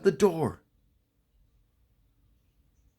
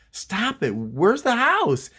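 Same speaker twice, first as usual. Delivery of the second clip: higher, drawn-out demand; exasperated sister; 7 semitones higher, slower than usual. Retold or imitated. Sister to her crying brother in the car, exasperated. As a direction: act out a sibling fed up with crying.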